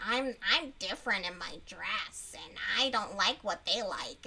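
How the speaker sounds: low, mocking teen voice; mocking impression of a special girl; mock not-like-other-girls. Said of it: "like, not like other girls".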